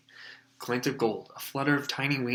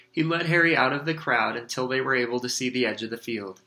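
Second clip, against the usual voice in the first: usual speaking voice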